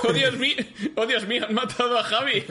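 high, loud, hammed-up alarm; mock shout. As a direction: cry out in fake horror.

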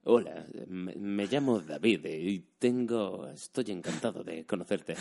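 Spanish dubbing voice, phrases held and drawn out; dubbing-actor delivery. Shown as a demonstration. Imitating dubbing actors introducing themselves, phrases sustained in dubbing accent. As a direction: sustain every phrase like a film dubber, slowly. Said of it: "la frase sostenida todo el rato".

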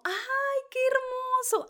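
very high gushing squeal. Real, not acted. Host gushing over the baby's name, squealing high. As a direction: melt over the cute news.

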